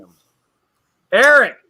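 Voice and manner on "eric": loud, high-pitched call; a raised, carrying shout of a name. Real, not acted. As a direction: call someone over by name. Calling a guest over.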